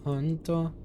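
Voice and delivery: playful high "honto"; high repeat. Shown as a demonstration.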